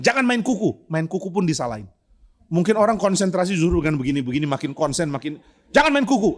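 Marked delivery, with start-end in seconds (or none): 0.0-0.9 Pak Tong scolding. Retold or imitated. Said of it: "Pak Tong bilang". 5.7-6.3 high, louder mock rebuke; Pak Tong scolding. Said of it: "Pak Tong bilang".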